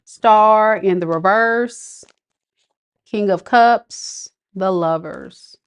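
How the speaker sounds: slow, deliberate naming of each card; slow card reading. Real, not acted. Reader turns over tarot cards for Lisa, slow and deliberate. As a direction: read out each card slowly, letting it land.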